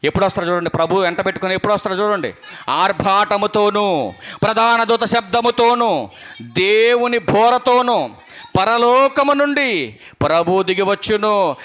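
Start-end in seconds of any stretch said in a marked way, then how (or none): 2.7-11.6 very slow, solemn reading of the verse, each phrase drawn out; slow scripture reading. Acted. Preacher reads the coming of the Lord to the church, slow and solemn. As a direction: read the verse slowly, weighing every phrase.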